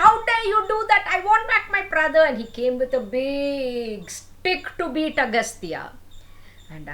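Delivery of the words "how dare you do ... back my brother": furious, much higher, louder, fast shout; very angry. Acted. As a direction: explode at the sage in rage. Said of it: "Then Ilvala was very angry".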